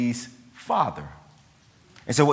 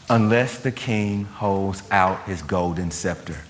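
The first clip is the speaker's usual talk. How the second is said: lightly voiced stern Mordecai; grave warning; 6 semitones lower than usual. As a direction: lower the voice like a grave elder.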